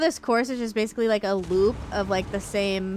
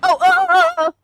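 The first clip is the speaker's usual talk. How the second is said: high, loud startled yelps, losing control; panicked yelps; 12 dB louder than usual. Real, not acted. Player suddenly steering a motorcycle, high panicked yelps. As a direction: yelp as the bike gets away from you.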